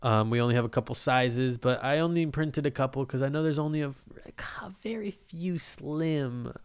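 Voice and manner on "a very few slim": slow conspiratorial whisper; whispered aside. Real, not acted. Host admitting he has only a few true fans, whispering. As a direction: whisper it like a secret.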